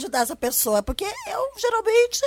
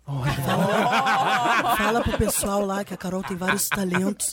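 low, laughing announcer voice; deep documentary voice; 5 semitones lower, much slower than usual. Shown as a demonstration. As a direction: drop into a deep narrator voice. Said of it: "também fazer essa voz".

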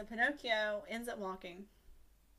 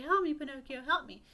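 raised, louder mock cry for rescue; pleading for help; 5 semitones higher, 6 dB louder than usual. Drowning dog begs Pinocchio, in an urgent pleading call.

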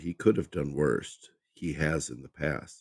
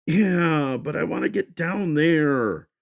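much higher and louder, petulant; whiny insistence; 12 semitones higher, 7 dB louder, slower than usual. Acted. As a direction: whine it like a spoiled kid who wants his way. Michael insists like a whiny child.

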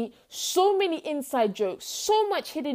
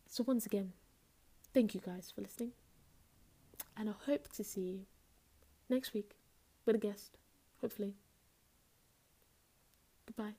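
smooth radio voice; 2 semitones lower, 12 dB quieter than usual. Pretend. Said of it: "smooth radio voice".

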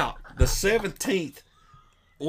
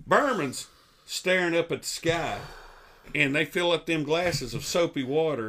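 ordinary talk, nothing marked

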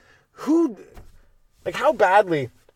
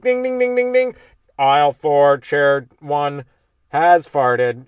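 mock alarm announcement; 4 dB louder, much slower than usual. Fart sensor announcement voice.

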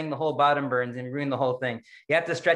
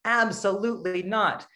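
normal talking voice

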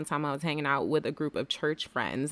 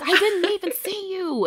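amazed disbelief; 13 semitones higher, 6 dB louder than usual. Retold or imitated. Sister's amazed reply, retold.

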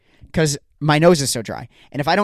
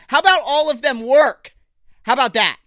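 exasperated rant; 7 semitones higher than usual. Real, not acted. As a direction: whine it up high in frustration. Ranting at Apple.

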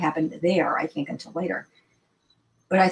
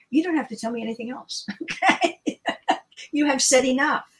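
amused retold reply breaking into laughter; laughing retort; 5 semitones higher than usual. Cutting off the physicist's story knowingly, laughing. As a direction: laugh through it, pitch rising.

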